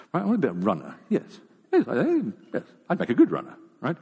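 mock-eager daydreamer talking himself round; high, excitable inner voice with a laugh; eager self-talk. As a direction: play at convincing yourself. Acting out his runner daydream.

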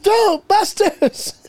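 mock movie-title read with laughter; drawn-out laughing echo. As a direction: stretch it out cracking up. Echoing the joke laughing.

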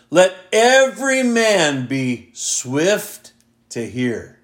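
slow, weighty verse reading with long pauses; emphatic scripture reading. Shown as a demonstration. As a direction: read it deliberately, leaning on each key word. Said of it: "read this with a little bit of emphasis".

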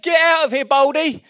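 loud mocking yell of the kids; jeering shout. Kids jeering at the prophet, loud mocking shout. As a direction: shout it like taunting children. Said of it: "they said".

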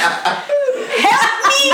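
high, laughing mock plea for help; a hammed-up husband's plea, giggling; mock whiny plea, laughing. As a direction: imitate him begging through a laugh. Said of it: "Me está como diciéndome".

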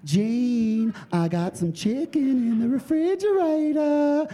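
high, drawn-out Southern singsong; sing-songy Southern. Shown as a demonstration. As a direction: croon it high like a Southern temptress. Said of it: "real Southern. "Sing-songy".

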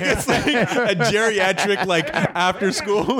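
amused, words stretched by laughing. Real, not acted. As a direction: laugh your way slowly through the words. Laughing at his own image.

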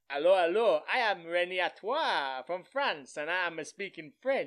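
loud hammed-up French accent, dropping the H's; mock French accent. Mocking the sitcom's French hero, hammed-up French accent. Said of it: "you're dropping your fucking H's".

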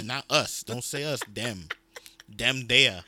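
US and Them punched loud; emphatic correction. Real, not acted. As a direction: hit Them hard, correcting the other speaker.

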